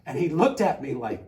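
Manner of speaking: ordinary delivery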